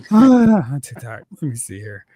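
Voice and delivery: usual speaking voice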